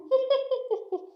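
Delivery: high, rapid tittering cackle; witch's cackle. Acted. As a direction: cackle gleefully like a wicked witch. Witch cackles at her victim.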